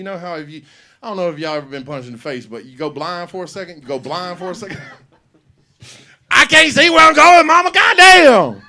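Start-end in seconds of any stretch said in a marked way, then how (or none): 6.3-8.6 high, loud, desperate shout; panicked yell. Retold or imitated. Son blinded from the slap yelling at mama, high and loud. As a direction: scream it, half blinded.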